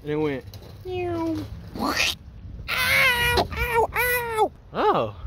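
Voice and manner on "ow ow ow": high pained ows; getting a leg cramp. Real, not acted. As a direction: cry out with a sudden cramp. Hurt, ouch cries.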